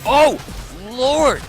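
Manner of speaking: loud, high cry of surprise; shocked outburst at the play; shocked exclamation. Real, not acted.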